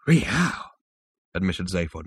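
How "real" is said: higher, awed reaction; much impressed. A narrator's reading. Zaphod admits he is much impressed, voice lifted. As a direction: let it out as impressed admission. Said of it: "much impressed".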